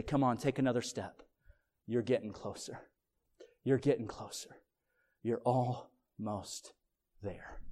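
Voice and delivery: low, very soft coaxing; gentle encouragement. Acted. God reaching down to a struggling walker, soft encouragement. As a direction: coax tenderly, slowing right down at the end.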